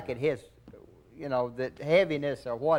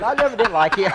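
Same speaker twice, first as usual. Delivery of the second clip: laughing scorn; 7 semitones higher, 8 dB louder than usual. Retold or imitated.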